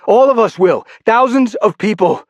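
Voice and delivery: high, loud desperate plea; urgent warning. Acted. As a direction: make him see the stakes. Pleading warning.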